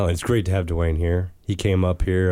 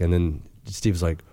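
ordinary delivery

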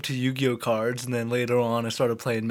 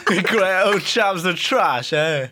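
slow, higher, hammed-up Cockney; badly faked Cockney, drawling and pitched up; Cockney accent; 5 semitones higher, 4 dB louder, slower than usual. Host clowning as a British chav, exaggerated Cockney. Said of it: "the Cockney voice".

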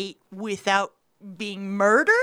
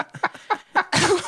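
high laugh; 7 semitones higher than usual. Real, not acted. Laughing at own joke.

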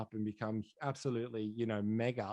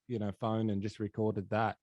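ordinary talk

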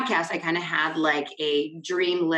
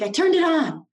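indignant insisting; 9 semitones higher than usual. Retold or imitated. Insisting to husband, retold.

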